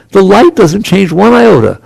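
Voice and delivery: ordinary talk, nothing marked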